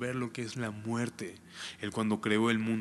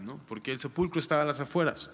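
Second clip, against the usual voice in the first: ordinary delivery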